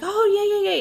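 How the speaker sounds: high loud yay yay yay; excited kid cheer. Retold or imitated. Mom imitating her excited son, high and loud. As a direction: squeal it like a thrilled little boy. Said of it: "And he's so excited".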